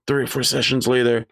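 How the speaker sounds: ordinary talk, nothing marked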